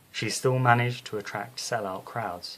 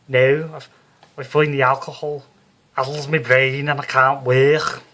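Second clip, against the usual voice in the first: loud, high, brassy Liverpool medium; Psychic Sue voice; 4 semitones higher, 10 dB louder than usual. Acted. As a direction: play her as a brash Scouse showwoman. Sue explains to landlord.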